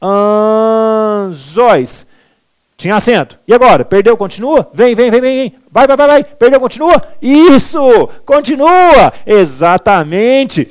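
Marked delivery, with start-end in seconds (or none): 0.0-2.1 "Anzóis" with a very long held first syllable; slow, drawn-out "Anzóis" for dictation; syllables separated. Shown as a demonstration. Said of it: "Vou separar". 4.7-9.1 high, loud, urgent egging on; rapid-fire high-pitched coaching; excited cheering on. Pretend. Teacher cheers on the quiz answer.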